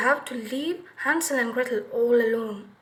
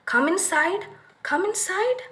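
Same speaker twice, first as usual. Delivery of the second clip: put-on sweet coaxing old woman, rising higher; 6 semitones higher than usual. A narrator's reading. Old woman luring the hungry children inside, coaxing. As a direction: lure the children in with a sugary call.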